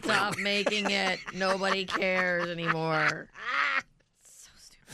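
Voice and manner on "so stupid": whispered. Real, not acted. Muttered exasperation.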